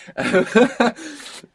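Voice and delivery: big burst of laughter; hearty laugh. Real, not acted. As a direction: laugh out loud. Laughs at gringo story.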